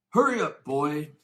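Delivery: high loud bellow from another room; loud high impatient shout. A narrator's reading. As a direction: yell across the house. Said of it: "shouted Uncle Vernon".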